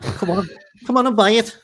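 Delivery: mock Scouse urging; bad Liverpool accent. Mock Scouse urging a Liverpool buyer to purchase. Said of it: "what kind of accent is that".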